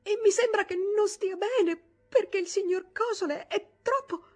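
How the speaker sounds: high, loud tearful stammering; distressed stammer. Acted. Maid Line to Toni, anguished tearful stammer. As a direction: stammer out the bad news in anguish. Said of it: "balbettò angustiata".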